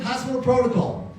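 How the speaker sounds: matter-of-fact statement of the rule; retold official line, falling at the end; official explanation. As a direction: cite the rule, lightly acted. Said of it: "He goes".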